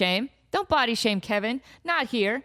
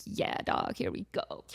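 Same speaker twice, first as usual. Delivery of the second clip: cat voice; 8 dB quieter than usual. Retold or imitated. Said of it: "He's like".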